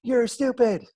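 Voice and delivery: put-on high, loud taunting child; bully voice. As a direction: sneer it like a schoolyard bully. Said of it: "they're gonna say".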